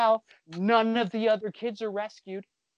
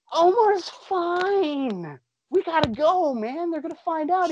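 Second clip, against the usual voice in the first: hammed-up Harris, pitched higher, drawn out; higher pressing Harris; 10 semitones higher, slower than usual. Harris urging Indy to leave Omar, higher hammed-up voice. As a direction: rush the kid out in a higher put-on voice.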